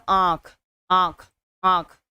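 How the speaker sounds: slow, separated आँख, vowel through nose and mouth; nasalised vowel. Shown as a demonstration. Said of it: "नाक और मुंह दोनों से उच्चारित".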